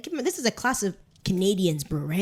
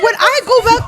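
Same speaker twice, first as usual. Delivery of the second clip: high, loud retold protest; hammed-up indignant shout; indignant retort; 11 semitones higher, 14 dB louder than usual. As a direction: protest it at full volume, half laughing. Retelling indignant retort to classmate.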